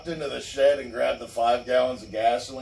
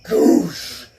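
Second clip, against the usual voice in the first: voiceless whoosh, louder; whooshing fire sound; 5 semitones higher, 9 dB louder than usual. Retold or imitated. Teller imitates gasoline hitting the fire with a loud whoosh. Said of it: "and I went".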